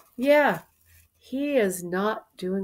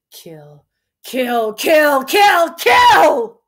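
kill repeated louder and higher each time until screamed; building to a shout; 7 semitones higher, 12 dB louder, much slower than usual. Acted. Lear's murderous rage builds.

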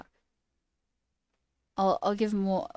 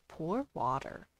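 quiet, slow parrot imitation; imitating a parrot; 4 semitones lower, 8 dB quieter, slower than usual. Streamer showing viewers what the parrot says, softly imitated. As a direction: lightly mimic a talking parrot, drawn out. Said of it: "the parrot says".

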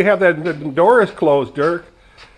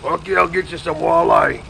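imitating buddy. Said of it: "he talks like this".

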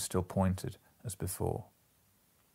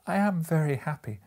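happy; 8 semitones higher, 4 dB louder than usual. A narrator's reading. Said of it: "said little Bob".

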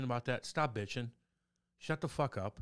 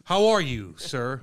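Jamal Maris; 13 dB louder, much slower than usual. Acted. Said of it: "heard you do a Jamal Maris impersonation".

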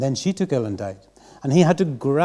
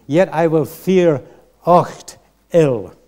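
Scots line again; 4 semitones higher, 7 dB louder, slower than usual. Shown as a demonstration. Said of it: "I'll give that line again".

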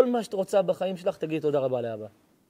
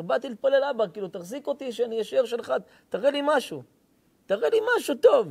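imitating desperate begging, much higher, rising to a cry; begging prayer; 7 semitones higher, 4 dB louder than usual. Man retelling his prayer to God, climbing desperate plea. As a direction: plead like a man at the end of his rope.

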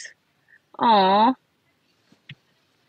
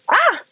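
sudden high squeal of surprise; surprised high shriek; 14 semitones higher, 3 dB louder than usual. Real, not acted. Streamer opening a gift from a viewer, squealing high in surprise. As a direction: yelp high at the unexpected.

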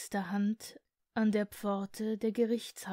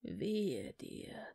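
lower, softer hollow whisper; 5 semitones lower, 6 dB quieter than usual. A narrator's reading. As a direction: breathe it out like a corpse. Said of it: "mit hohler Stimme".

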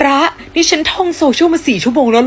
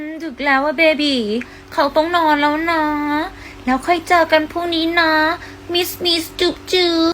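cutesy lover talk; 3 semitones higher than usual. Acted. Girlfriend cooing goodnight to her boyfriend. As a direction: coo goodnight to your sweetheart.

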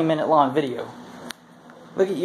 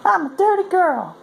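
slow, very high falsetto imitation; mock female partner; 4 dB louder than usual. Man quoting what she goes, mock slow falsetto. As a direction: draw it out in a put-on woman's falsetto. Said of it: "She goes".

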